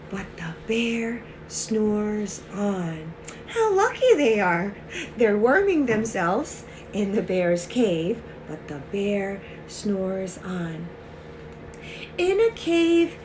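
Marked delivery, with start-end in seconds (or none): 0.1-3.1 slow, low and soft narration; sleepy story refrain. A narrator's reading. Reading of a snoring bear to children, sleepy story refrain. 8.5-10.9 slow, low and soft narration; sleepy story refrain. A narrator's reading. Reading of a snoring bear to children, sleepy story refrain.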